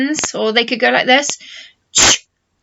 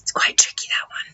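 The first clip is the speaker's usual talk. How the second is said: whisper; 5 dB quieter, faster than usual. Real, not acted. Aside to children.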